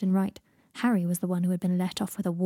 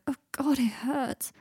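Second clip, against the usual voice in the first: higher, whining groan of pain; pained complaint; 5 semitones higher, slower than usual. Acted. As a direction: moan it in self-pity. Draco complains of his aching head.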